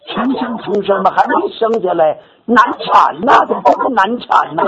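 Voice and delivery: high, urgent telling of the anxious sisters; lively high storytelling about a hard birth; excited. Real, not acted. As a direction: pitch it up as if the labour won't end. Sisters anxious, rebirth like difficult labour.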